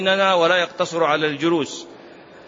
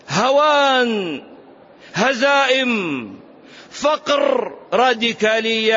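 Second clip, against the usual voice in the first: higher, drawn-out emphatic preaching; 4 semitones higher, slower than usual. Real, not acted. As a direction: hammer out each rejected thing, word by word. Preaches with rising force. (وعظ حماسي بنبرة مرتفعة)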